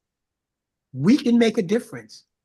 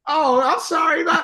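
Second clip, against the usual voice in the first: high, startled apology in a put-on voice; surprised stranger; 12 semitones higher, 6 dB louder than usual. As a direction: mimic a flustered stranger, pitched way up. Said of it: "They'll go".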